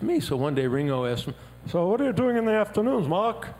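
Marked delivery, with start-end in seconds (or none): 1.7-3.5 a touch of a chatty Liverpool drummer. Retold or imitated. [name] quoted in a story.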